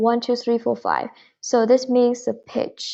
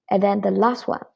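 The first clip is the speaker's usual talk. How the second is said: ordinary delivery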